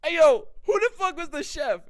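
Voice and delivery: loud, high amazed call; excited shout. Retold or imitated. Praising his own food, loud high excited shout. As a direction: yell out for the chef. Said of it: "I'm like".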